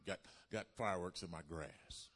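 normal talking voice

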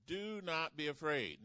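loud, slow, proclaiming reassurance; booming voice of God. Acted. God telling Abram not to fear, loud and slow. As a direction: call it out slowly like God speaking. Preacher reads God's words to Abram.

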